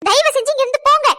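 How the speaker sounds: loud, high-pitched outburst; furious shout. Acted. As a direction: throw them out at the top of your voice. Final order to leave.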